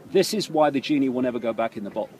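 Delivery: ordinary talk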